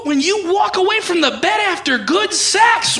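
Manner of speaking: usual speaking voice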